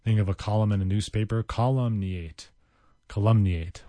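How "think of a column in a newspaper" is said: normal talking voice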